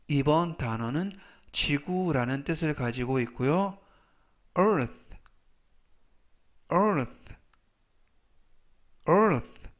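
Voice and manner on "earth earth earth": earth said clearly three times, with pauses to repeat; careful pronunciation demo. Shown as a demonstration. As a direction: model earth for learners to copy. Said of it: "라고 발음하시면 되겠습니다". (earth를 또박또박 세 번 발음 시범)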